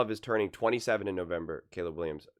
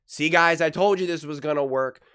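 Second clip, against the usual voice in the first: louder, higher I-told-you-so; hammed-up gloating at the audience; mock gloating; 4 semitones higher, 6 dB louder than usual. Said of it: "jump on here and say".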